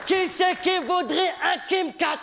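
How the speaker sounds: high, slow shouted offer; mother calling out. Retold or imitated. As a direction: shout like a mom selling candy.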